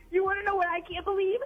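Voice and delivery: ordinary delivery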